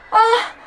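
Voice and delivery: high frightened cry; panicked yelp. Real, not acted. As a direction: cry out in fear. Fly near her, screams.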